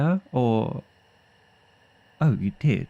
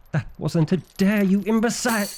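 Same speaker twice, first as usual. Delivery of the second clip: higher, exasperated shout at the fool; angry outburst; 10 semitones higher, faster than usual. Acted. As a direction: snap it out, pitch climbing on imbecile. Book opened against his warning.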